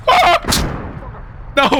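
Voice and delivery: high-pitched yelp, then a cry of no; sudden high scream under attack; startled scream. Real, not acted. Player ambushed in a game, startled scream.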